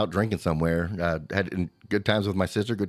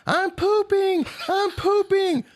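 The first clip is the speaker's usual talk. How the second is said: put-on high, shrill child's yell, much higher; little girl yelling; much slower than usual. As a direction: yell it like a small child in a panic.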